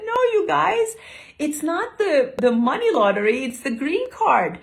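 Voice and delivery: high, loud retold protest; excited correction. Au pair correcting kids about the lottery, excited. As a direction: correct them loudly, starting way up high.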